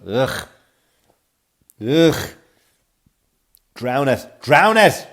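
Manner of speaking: groan, then snap "drown it" in mock outrage; hammed-up revulsion at a crying baby; disgusted groan.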